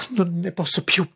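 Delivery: high, desperate cry. A narrator's reading. Daisy crying out to everyone, desperate and high. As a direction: break out at the end of her rope. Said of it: "gridò Daisy".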